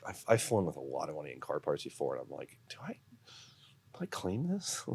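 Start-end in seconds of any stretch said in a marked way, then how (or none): 2.7-4.8 high, slow, hesitant whisper; soft, squeaky, unsure whisper; mock nervous whisper. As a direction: whisper it like a guilty secret. Nervous secretive question at customs.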